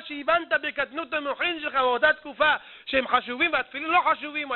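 usual speaking voice

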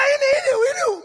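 high, loud cries of discovery; wildly excited finder; frantic excitement. Acted. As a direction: shriek it as the treasure appears. Digger finds the treasure.

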